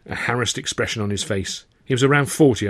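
normal talking voice

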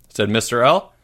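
ordinary delivery

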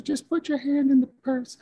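put-on high sweet old-lady voice; imitating a kindly old lady, very high; Missus Doubtfire. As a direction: coax a child in a falsetto granny voice. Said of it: "sweet old lady".

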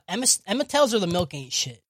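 normal talking voice

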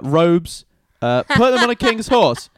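high, loud list of grand orders; eager proclamation. Acted. Haman dictating honors he expects for himself, eagerly. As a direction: proclaim each item with rising relish.

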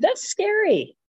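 alarmed. Real, not acted. Man almost stepped off curb.

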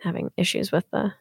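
quiet gravelly voice; rough, gravelly morning voice. Real, not acted. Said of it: "so gravelly today".

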